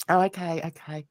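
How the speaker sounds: ordinary talk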